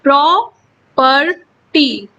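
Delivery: "Property" slowly in three parts, pausing between each; teacher's slow syllable-by-syllable "property"; split into syllables. Shown as a demonstration. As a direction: break "property" into प्रॉ, पर, टी with clear gaps. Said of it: "यहाँ पर तीन syllable हैं".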